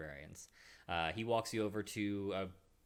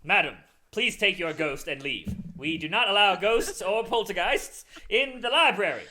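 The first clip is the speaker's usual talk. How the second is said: louder, higher clipped refusal; stern librarian; 9 semitones higher, 12 dB louder than usual. Acted. Librarian ordering the visitor out, stern and loud. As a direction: throw the ghost out, firmly.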